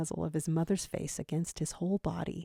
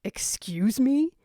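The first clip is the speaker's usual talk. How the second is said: offended; 6 semitones higher, 6 dB louder, slower than usual. A narrator's reading. Offended at being called snuggly.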